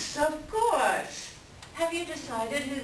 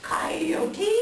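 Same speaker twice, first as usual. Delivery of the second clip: louder breathy disbelieving question; appalled whisper; 5 semitones higher, 4 dB louder than usual. Acted. Raven repeats the name in horror to the elder.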